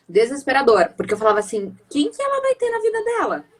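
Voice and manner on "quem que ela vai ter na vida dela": high-pitched anguished retold question; desperate question. Mother voicing her old fear for her daughter, desperate. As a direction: voice pitched way up, asking in worry.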